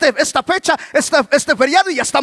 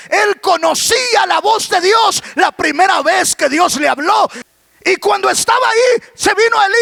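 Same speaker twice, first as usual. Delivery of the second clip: higher-pitched, projected preaching; predicación enérgica; 8 semitones higher than usual. Real, not acted. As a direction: drive it home to the congregation, pitch held high. (prédica con fuerza y tono alto)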